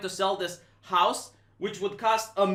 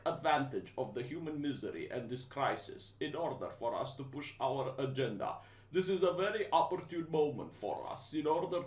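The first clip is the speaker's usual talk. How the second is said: low, soft, unhurried captain's announcement; lower, softer, smooth corporate calm; Schwab impression; 7 semitones lower, 8 dB quieter than usual. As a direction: calmly announce a sinister plan like a pilot over the intercom. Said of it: "this is how he speaks".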